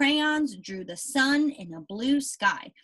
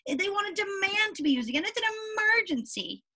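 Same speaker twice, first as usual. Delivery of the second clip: much higher, fast and urgent, like panicked crayons; upset writing tools; 9 semitones higher, faster than usual. Pretend. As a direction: sound the alarm for the neglected pens. Teacher voices upset tools.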